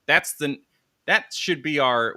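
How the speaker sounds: normal talking voice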